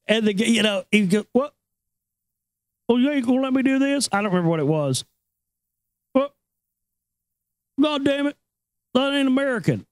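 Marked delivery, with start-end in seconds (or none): 1.3-4.1 high-pitched mock indignant customer; put-on whiny complaint; obnoxious customer. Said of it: "he was just a total douche". 6.1-9.8 high-pitched mock indignant customer; put-on whiny complaint; obnoxious customer. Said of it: "he was just a total douche".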